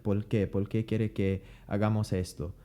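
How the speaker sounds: Por qué said pol qué, s dropped, Puerto Rican accent; relaxed Puerto Rican Spanish with l for r. Shown as a demonstration. Teacher demonstrating Puerto Rican Spanish. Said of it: "pronounce the R's like L's".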